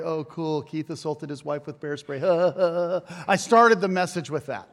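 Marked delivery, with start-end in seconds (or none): 2.3-3.2 breathy burst of laughter; short laugh. Real, not acted. Preacher recalling his bear spray story, short laugh. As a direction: laugh it off briefly.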